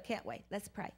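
ordinary talk, nothing marked

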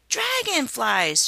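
high, slow thrilled cry; exulting. A narrator's reading. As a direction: gasp it with rapture. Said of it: "she exulted".

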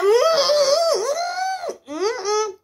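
very high wordless whimper, falling off; high-pitched "mm" wail of refusal; pained whining hum. Retold or imitated. Patient refusing to be moved on the machine, whining whimper. Whimpering while being positioned.

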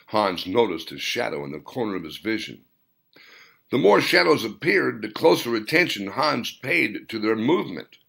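usual speaking voice

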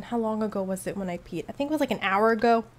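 usual speaking voice

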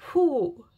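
a big shaky-free whew, letting emotion out; loud relieved whew after near tears; emotional loud exhale. Real, not acted. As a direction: blow out hard to steady yourself. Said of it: "I don't think I've cried in a reading".

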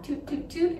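high mock toot noises, playful; imitated toots. Granddaughter mimicking grandma's toots in the mall, high playful. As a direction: puff out little high toots like tiny farts. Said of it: "I'd be walking behind her".

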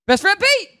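high, loud shout of the name; announcer's big introduction. Real, not acted. Host introducing best friend from LA, big announcer shout. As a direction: bring the guest on at full volume.

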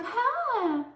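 big, high-pitched Texas twang; Texas accent. Retold or imitated. Blonde girl comes up to me, big Texas accent. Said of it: "with this big old Texas accent".

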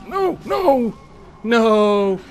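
loud despairing high yells; anguished yell. Real, not acted. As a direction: scream it as you lose. Dying just before a kill.

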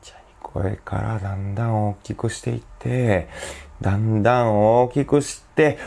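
slow build from hushed to full voice. Shown as a demonstration. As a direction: start tiny and steadily swell the volume.

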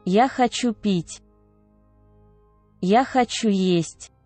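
ordinary talk, nothing marked

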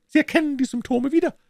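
high-pitched, pleased questioning; gloating villain Smith. A narrator's reading. Smith questions his sick victim, gloating high voice. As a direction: crow over the symptoms.

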